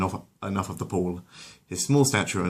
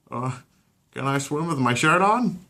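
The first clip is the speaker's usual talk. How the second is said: put-on higher, hesitant teen boy; sheepish teen voice; 7 semitones higher, 3 dB louder than usual. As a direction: ask shyly, embarrassed. Insecure Chris asks.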